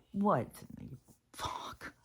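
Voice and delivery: slow, breathy whisper of shock; whispered disbelief. Real, not acted. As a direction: hiss it out in stunned disbelief. Disbelief after rant.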